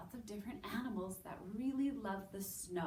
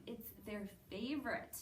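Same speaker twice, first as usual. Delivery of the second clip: ordinary delivery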